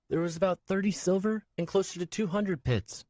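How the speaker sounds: put-on high young boy's voice, pleading; a small boy reminding the man; child voice. Nico to Swen, a high boy's voice.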